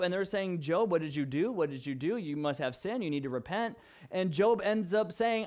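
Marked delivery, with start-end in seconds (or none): none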